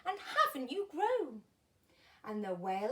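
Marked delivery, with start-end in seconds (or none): 0.0-1.4 higher, louder, lightly laughing greeting; fond welcome. A narrator's reading. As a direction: welcome old friends home. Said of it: "who said".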